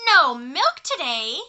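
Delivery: loud, slow outraged question; cried out. Acted. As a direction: cry it out in shock. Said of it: "cried Farmer Brown".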